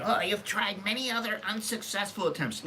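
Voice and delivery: high sneering mimicry of a viewer; mocking the commenter. Mocking a viewer's comment, high and sneering. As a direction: parrot his words back, pitched high and snide. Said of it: "this guy's saying".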